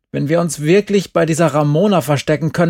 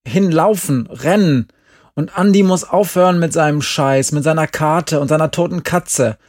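angry; 6 semitones higher, 6 dB louder than usual. A narrator's reading. Glenn snaps at Andi.

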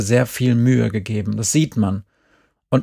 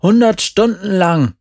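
high, louder, exaggerated protest; exasperated cry; 8 semitones higher, 5 dB louder than usual. A narrator's reading. Jerry answers mother about explaining it to teacher, exasperated cry. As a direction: call it out in exasperation. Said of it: "rief Jerry".

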